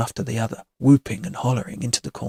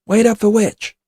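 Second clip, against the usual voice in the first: loud, raised holler to the group; yelling; 6 semitones higher, 7 dB louder than usual. A narrator's reading. Gorilla tells the boys to wait, yelling. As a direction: yell to those up ahead. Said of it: "Gorilla yells".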